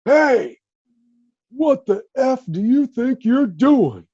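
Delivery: high, loud furious shout; retold angry yell, slowed and loud. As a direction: bark it like a player catching an intruder. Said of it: "and I hear".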